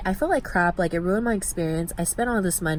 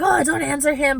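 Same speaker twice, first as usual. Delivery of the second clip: urgent inner plea; 8 semitones higher than usual. Retold or imitated. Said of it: "in my head, I was like".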